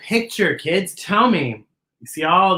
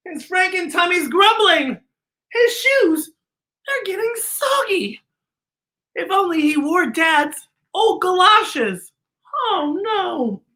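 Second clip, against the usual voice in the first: high dramatic reading; 9 semitones higher than usual. Acted.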